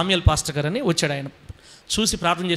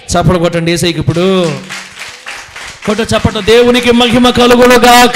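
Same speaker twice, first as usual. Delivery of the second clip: rousing shout; 2 semitones higher, 10 dB louder than usual. Real, not acted. Preacher calls congregation to clap.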